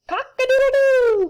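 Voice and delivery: loud, high crowing rooster. Acted. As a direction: belt it out like a proud rooster at dawn. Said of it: "crowed Rooster".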